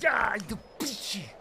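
unvoiced, breathy whisper; whispered curse. Real, not acted. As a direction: hiss the curse under breath. Cursing after loss.

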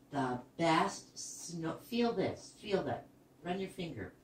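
ordinary delivery

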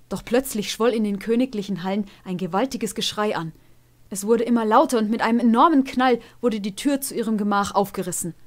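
usual speaking voice

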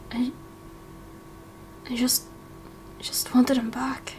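whispered, broken confession; tearful whisper. Acted. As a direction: let it barely come out, grieving. Grieving confession repeated, whispered.

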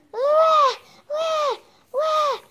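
high, loud fake baby cries; baby wailing. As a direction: wail like a crying baby. Doll crying again.

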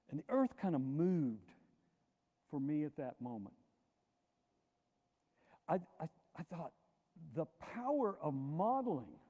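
ordinary delivery

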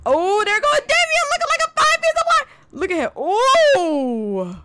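high, swooning admiration; excited. Real, not acted. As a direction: swoon over him, pitch way up. Admiring her crush arriving.

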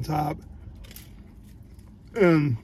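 talking while chewing; words muffled by food; mouth full of pizza. Real, not acted. Seen 1: Bites the slice at 4.6, then chews with puffed cheeks.

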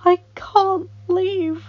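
high, slow, loud anguish. A narrator's reading. Child prince refusing to leave, anguished. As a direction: draw out the plea, pitched high. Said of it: "Adam thought in anguish".

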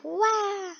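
high-pitched drawn-out mock "wah"; mimicked wail. Viewer imitating the other player, high mock wail. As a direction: echo the wail up high, without shouting. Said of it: "The other one's like".

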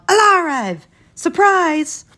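high, loud yell, popping out of hiding; excited yell. A narrator's reading. Two children popping up from hiding to surprise their family, high excited yell. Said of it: "popped up and yelled".

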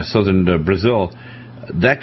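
normal talking voice

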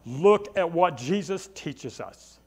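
ordinary delivery

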